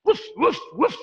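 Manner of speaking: short staccato woofs; dog barking. Shown as a demonstration. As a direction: imitate a dog with quick, separate barks. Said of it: "Like a dog".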